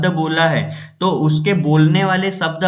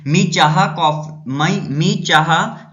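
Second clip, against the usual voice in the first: slow, undecided, weighing options; hesitant choice; much slower than usual. Shown as a demonstration. Teacher demonstrating a choice between tea and coffee, slow and undecided. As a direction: drag it out as if you can't choose. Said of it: "एक और मैं example बोलता हूँ".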